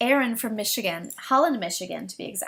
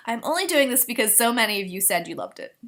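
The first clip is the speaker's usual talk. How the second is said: ordinary delivery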